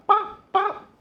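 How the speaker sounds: high, loud bop, bop; penguin call. Pretend. Cooking vlogger tries a penguin call, high and loud. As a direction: pop each bop out high and loud like a bird. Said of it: "would that be the sound I make".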